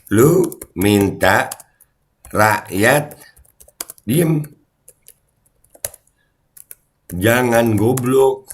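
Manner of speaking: scolding the viewers word by word; slow emphatic scolding. Real, not acted. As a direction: drag every word out slowly.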